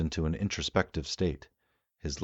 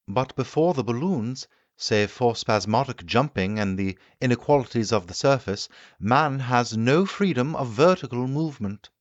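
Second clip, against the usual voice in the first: higher, louder, eager explaining voice; the keen inventor holding forth to his guests; the Time Traveller lecturing; 8 semitones higher, 7 dB louder than usual. Acted. Time traveller answers the doctor.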